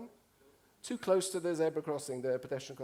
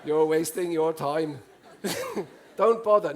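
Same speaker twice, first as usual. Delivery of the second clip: imitating Norwegians; 8 dB louder than usual. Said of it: "I can see Norwegians doing this".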